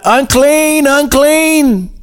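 high, drawn-out call of unclean; leper's cry. A narrator's reading. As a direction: call it out high like an outcast warning the street. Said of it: "you had to yell out".

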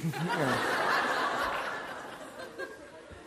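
trailing real laughter; long laugh. Real, not acted. Pastor laughing at his friend's punchline, long and fading. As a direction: laugh it out as it fades.

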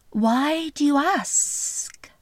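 slow, sly snake voice; a lightly voiced, unhurried snake. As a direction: draw out every word like a slithering snake. Snake voiced in fairy tale.

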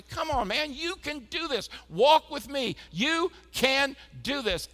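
high, fired-up pep talk; pumped-up encouragement. Pretend. God patting him on the back, a high fired-up pep talk. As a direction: cheer him on like a coach.

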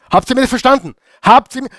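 high, pressing check-in; emphatic question. Real, not acted. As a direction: push the congregation for an answer. Pastor demanding understanding.

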